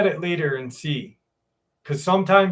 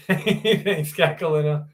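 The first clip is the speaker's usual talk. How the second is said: normal talking voice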